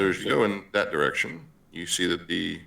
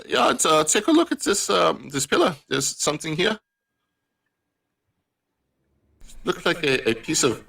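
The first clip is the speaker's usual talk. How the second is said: put-on a Johan voice in a thick foreign accent, pitched much higher; 9 semitones higher than usual. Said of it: "The accent is, is a little thick sometimes".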